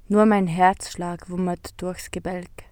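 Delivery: plain reading voice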